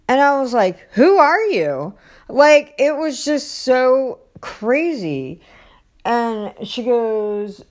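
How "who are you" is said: high, louder incredulous question; baffled retort, pitched up; retold disbelief. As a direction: challenge a stranger at the door, taken aback. Retells startled reply to stranger.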